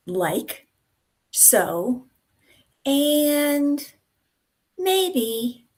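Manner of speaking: filler words. Shown as a demonstration. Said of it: "that's more than just um and ah".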